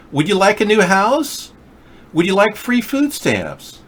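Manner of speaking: high, sugary mock offer; mocking Democrats. Mocking Democrats offering handouts, high and sugary.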